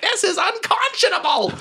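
a cartoonish tyrant bursting in; furious brothel boss. Chaz storming into his brothel, shrill and outraged.

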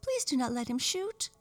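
high, worried doll plea; dainty doll imploring, pitched high; pleading cry. Acted. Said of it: "cried the French dolly".

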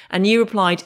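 ordinary delivery

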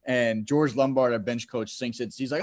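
normal talking voice

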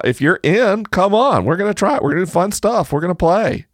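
high, eager invitation; excited. Real, not acted. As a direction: pump them up to join in. Excitedly inviting listeners to play.

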